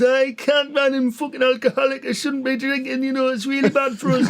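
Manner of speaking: high-pitched protesting drinker impression; Gaza. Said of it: "He goes".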